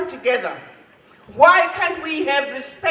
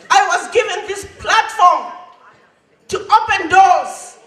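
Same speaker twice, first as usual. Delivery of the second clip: rising, ringing preacher's call; rousing oratory; 4 semitones higher than usual. Acted. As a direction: lift the hall with each "you". Rousing climax of his speech.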